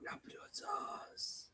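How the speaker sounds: breathy whispered echo. Pretend. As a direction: whisper it like a secret.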